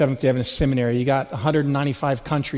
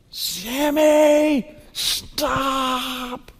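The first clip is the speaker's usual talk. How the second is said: much higher, frantic yell breaking into a cry; desperate yell; 9 semitones higher, much slower than usual. Retold or imitated. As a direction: scream his son's name to stop him. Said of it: "yelled at the top of his lungs".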